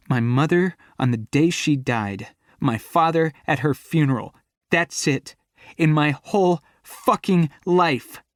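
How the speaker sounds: fighting back tears; bitter fury. Acted. Angry tearful confession.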